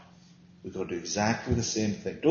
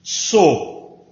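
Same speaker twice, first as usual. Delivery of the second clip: "So." hit hard, louder and higher; weighty stand-alone "So."; emphasis on So; 6 semitones higher, 10 dB louder than usual. Shown as a demonstration. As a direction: punch the single word "So." as a key point. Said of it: "I put some emphasis in there".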